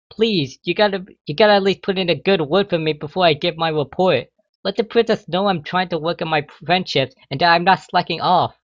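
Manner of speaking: high, urgent desperate begging; desperate plea. A narrator's reading. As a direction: beg mom for a good word. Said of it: "desperately pleaded".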